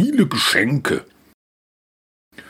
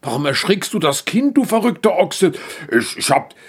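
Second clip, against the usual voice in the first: hoch und gereizt schimpfen; empörter Ochse; 9 semitones higher, faster than usual. Acted. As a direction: den Esel aufgebracht anfahren. Ochse schimpft über den Esel. (Ochse fährt den Esel wegen des Lärms empört an)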